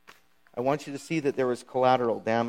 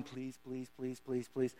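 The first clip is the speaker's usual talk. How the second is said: much softer, under-the-breath begging; desperate pleading; 13 dB quieter, slower than usual. Retold or imitated. Achan silently begging as the tribes are called, hushed plea. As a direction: pray nobody picks you. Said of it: "Achan's sitting there going".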